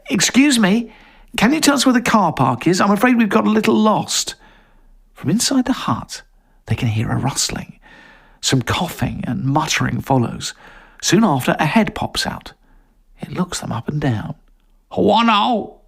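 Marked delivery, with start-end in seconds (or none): 0.1-4.3 polite raised call. Acted. Calling politely into a hut. 14.9-15.8 villager voice. Acted. Villager answers in strange tongue.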